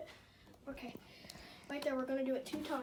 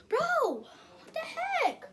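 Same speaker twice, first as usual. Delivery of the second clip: shocked; 12 semitones higher, 6 dB louder, much slower than usual. Acted. Skit, tablet suddenly broken.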